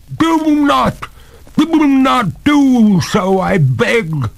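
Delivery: very high, loud begging; desperate ghost lady, words stretched; crying out. A narrator's reading. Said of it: "cried the ghost".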